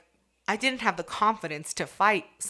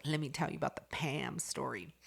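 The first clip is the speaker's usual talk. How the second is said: hushed, secretive whisper; teasing whisper; 4 semitones lower, 8 dB quieter than usual. Retold or imitated. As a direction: lean in and whisper the gossip. Retold quote, whispered tease.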